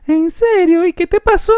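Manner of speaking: very high put-on voice; high-pitched astonished bystander; mock incredulous onlooker. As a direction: squeak it out in mock disbelief. Voices people seeing the old photo.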